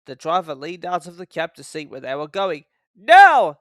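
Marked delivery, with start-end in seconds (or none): 2.9-3.6 high, very loud burst; spring the trick now; sudden cry. A narrator's reading. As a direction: Thomas jerking his driver off, sharp loud cry. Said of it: "said Thomas to himself".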